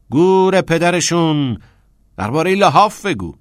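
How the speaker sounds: high, loud dismissive command; forceful urging. A narrator's reading. Teacher tells pupil to ignore laughing boys, forceful urging. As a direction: brush off the laughers and press him.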